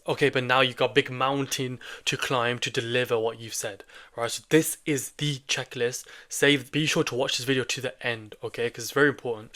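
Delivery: ordinary delivery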